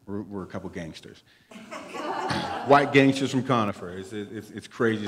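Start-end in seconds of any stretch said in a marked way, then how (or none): 1.5-2.6 breathy laugh. Real, not acted. Laughs at gangster joke.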